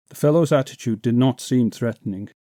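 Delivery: ordinary delivery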